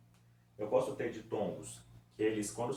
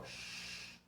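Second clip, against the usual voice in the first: sh chiado sem voz; hissed sh sound; 11 dB quieter than usual. Shown as a demonstration. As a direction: solta só o chiado sh. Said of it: "esse som pode ser representado só pelo X". (chiado "sh" isolado, sem voz)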